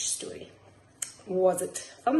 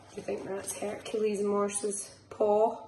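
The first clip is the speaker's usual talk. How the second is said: ordinary delivery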